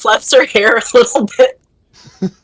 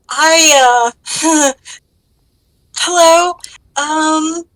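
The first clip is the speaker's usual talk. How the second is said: high, halting, flustered hello; tongue-tied princess with a nervous laugh; struggling for words; 9 semitones higher, 3 dB louder, much slower than usual. Acted. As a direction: fumble for words, pitched up. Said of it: "Seeing the princess struggling for words".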